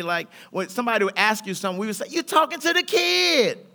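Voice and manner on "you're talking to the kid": strut the words out slowly; swaggering boast. Retold or imitated. Cousins' youthful catchphrase, slow and swaggering. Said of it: "And we would say".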